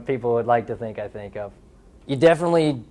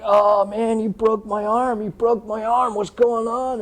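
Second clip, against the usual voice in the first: high, pained fake cry that the arm is broken; mock injured panic; 9 semitones higher, slower than usual. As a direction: pretend the elbow is shattered, higher voice. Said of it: "I come up all shaking".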